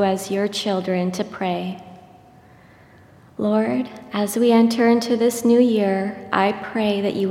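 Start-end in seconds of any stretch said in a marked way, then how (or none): none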